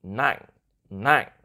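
"nặng" short and loud, stopping abruptly. Shown as a demonstration. Host naming the last tone, "nặng" quick and stopped. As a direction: cut "nặng" off sharply. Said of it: "as you can hear, it goes".

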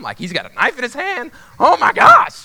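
rising frantic inner monologue, ending loud; acted panic. Thinking whether to tackle knifeman, building acted panic. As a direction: climb in pitch to a mock-panicked cry.

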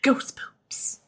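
soft whispered sign-off. Real, not acted. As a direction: breathe it out as a whisper.

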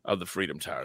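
ordinary delivery

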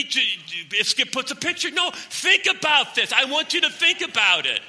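high, loud, urgent pulpit exhortation; full-force preaching. Real, not acted. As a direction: hammer the warning into the congregation at the top of your voice.

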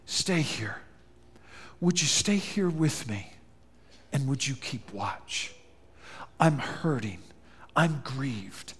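quiet, hurting request; soft grieving plea. Acted. Jesus asking his closest friends to keep watch, quietly hurting. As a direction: ask them softly to stay. Jesus pleading, voiced softly.

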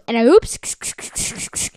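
slow, drawn-out breathy "And I oops"; slow meme-voice "And I oops"; VSCO girl imitation. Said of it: "a person who goes".

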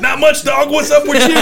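high, loud gleeful comeback; gleeful punchline. Retold or imitated. Prankster springing the joke on a coworker, gleeful. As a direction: crow the punchline through laughter.

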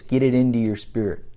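ordinary talk, nothing marked

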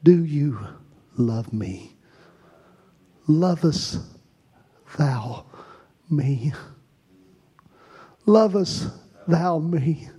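a hint of a soft, hushed Jesus. Jesus asking the church, softly hushed. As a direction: ask it gently, with long pauses. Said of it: "I saw him standing in this room and said".

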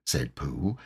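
usual speaking voice

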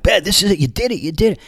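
high, quick teasing cheer; excited-sounding ribbing, pitched well up; mock-cheering razz. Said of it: "razzing him a little bit".

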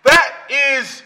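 loud, driving sermon voice; booming pulpit delivery; preaching at full force. Acted. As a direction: preach it hard to the congregation.

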